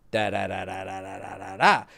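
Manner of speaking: low droning string of das; unvarying low, soft syllables; monotone da-da-da. Shown as a demonstration. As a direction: drone on one dull note. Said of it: "You can't have a song that's like".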